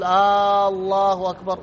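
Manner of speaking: long "aaa" opening الله in الله أكبر; stretched opening hamza. Shown as a demonstration. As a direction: draw out the first vowel, "Aaallahu akbar", like a question word. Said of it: "هذا خطأ، هذا سؤال". (مد همزة "الله" في "الله أكبر")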